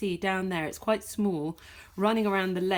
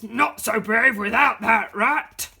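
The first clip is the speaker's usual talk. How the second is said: louder, slow, menacing growl of a voice; menacing dog; 2 semitones higher, 9 dB louder than usual. Acted. Dog Slim taunting the lone squirrel, slow and menacing. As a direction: loom over the squirrel as a bully dog.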